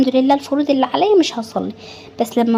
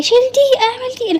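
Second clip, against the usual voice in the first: much higher, sharp mock scolding of a child; snapping at the kid; 11 semitones higher than usual. Tired mother scolding her child, mock sharp and high. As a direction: bark orders at the kid who throws things.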